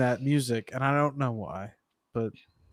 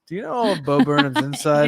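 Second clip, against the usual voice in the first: louder mock question, leaning on "Inside"; mimicked inflection; 6 dB louder than usual. Teasing the friend who said it at an inflection, mimicked inflection. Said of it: "You said that at an inflection".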